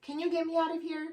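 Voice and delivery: high, fast pleading question; a patient begging the next nurse to let her out. Retold or imitated. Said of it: "they go to the next person and they're like".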